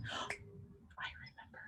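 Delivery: gasp, then a whispered aside; whispered discovery. Real, not acted. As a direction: whisper it like a secret you just recalled.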